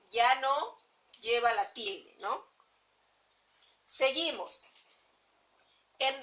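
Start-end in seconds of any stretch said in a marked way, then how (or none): none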